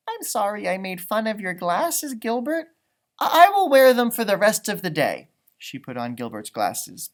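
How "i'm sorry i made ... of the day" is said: Margaret's apology. Acted. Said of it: "Margaret said".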